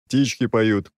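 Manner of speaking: ordinary delivery, nothing marked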